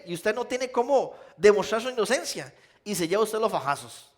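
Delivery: usual speaking voice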